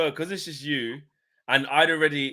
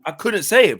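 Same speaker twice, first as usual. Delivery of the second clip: usual speaking voice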